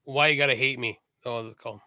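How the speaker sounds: ordinary talk, nothing marked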